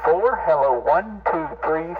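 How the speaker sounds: ordinary delivery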